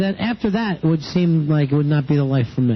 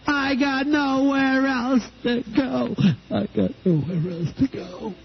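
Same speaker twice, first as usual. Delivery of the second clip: high, slow, whiny sobbing; mock crying; 8 semitones higher, 3 dB quieter, slower than usual. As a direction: wail it like a fake cry. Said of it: "you're really good at crying and yelling".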